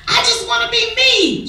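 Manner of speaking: high, loud desperate scream. Acted. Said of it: "she screams".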